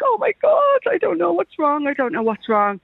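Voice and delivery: high, frantic cry of panic; pleading in rising panic, close to tears; panicked plea. Retold or imitated. Caller retelling customs panic, high frantic voice.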